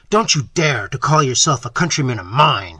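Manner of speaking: growling. A narrator's reading. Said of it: "he growled".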